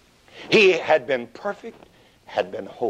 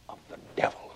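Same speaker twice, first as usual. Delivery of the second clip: whisper; 12 dB quieter, much faster than usual. Acted. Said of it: "Jesus in the garden".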